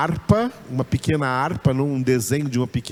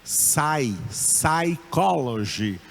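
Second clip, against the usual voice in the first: psychology slowly in English, sai-ko-lo-jy with a silent P; English pronunciation; much slower than usual. Shown as a demonstration. Teacher modelling the English word psychology, slow. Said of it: "psicologia em inglês é psychology".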